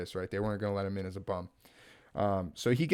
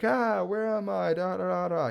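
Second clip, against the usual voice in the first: put-on dazed girl; 7 semitones higher, slower than usual. Woman voiced waking in sack.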